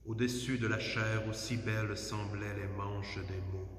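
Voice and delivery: ordinary delivery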